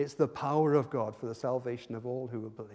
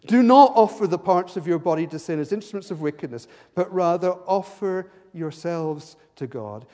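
loud, high preaching of scripture; full-force preaching; 4 semitones higher, 15 dB louder than usual. Acted. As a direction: thunder the verses out to the congregation.

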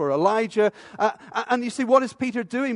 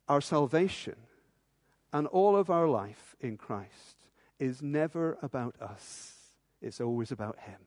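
hushed emphasis; 5 semitones lower, 7 dB quieter than usual. Acted. Preacher hushing the congregation. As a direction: drop low and soft for the key truth. Solemn climax of the sermon.

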